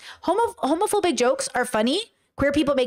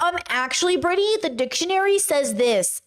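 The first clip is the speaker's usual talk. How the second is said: mock pedantic correction, pitched up; higher put-on "um, actually" voice; know-it-all commenter; 4 semitones higher than usual. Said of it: "the Rileys in my community to be like".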